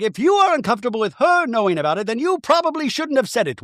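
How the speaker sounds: high, hammed-up reproach; mock scolding. Castmate scolding Whitney over gossip, mock-reproachful.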